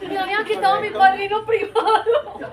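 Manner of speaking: high, loud, through laughter; laughing punchline. Real, not acted. Laughing loudly as she delivers the punchline to the audience. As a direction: laugh the words out.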